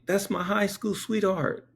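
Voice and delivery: high tearful whine, imitated; mock-tearful protest; imitated crying. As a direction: mimic a bawling husband, pitched high. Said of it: "He's crying like that".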